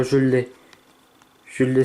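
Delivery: normal talking voice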